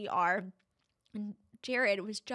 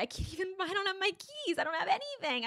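high-pitched panic; 10 semitones higher than usual. Retold or imitated. Realizing keys and wallet lost.

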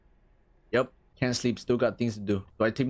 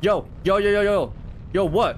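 loud, higher startled calls; alarmed yelling; 6 semitones higher, 3 dB louder than usual. Real, not acted. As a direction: call out in a panic. Ghost kids leaving their beds.

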